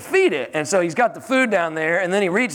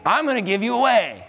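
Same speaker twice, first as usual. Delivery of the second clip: firm telling-off; 5 semitones higher than usual. Acted. Acted telling-off of money.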